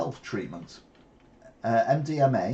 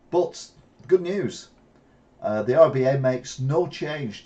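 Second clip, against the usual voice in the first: upbeat; 4 semitones higher, 6 dB louder than usual. Real, not acted. Perks up for good news.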